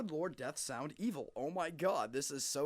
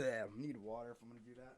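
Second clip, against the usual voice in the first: ordinary talk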